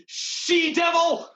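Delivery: high, loud, slow shriek of rage; furious curse. Acted. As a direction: hurl the insult with all your fury. Villain curses heroine.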